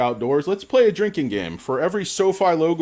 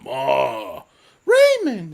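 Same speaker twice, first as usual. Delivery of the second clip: sitcom shout; 5 dB louder, much slower than usual. Retold or imitated. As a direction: bellow it like a sitcom family. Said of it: "It's all based on, like, people's voice".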